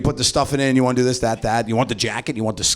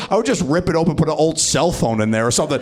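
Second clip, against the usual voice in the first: normal talking voice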